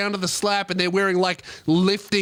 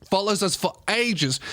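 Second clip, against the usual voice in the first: usual speaking voice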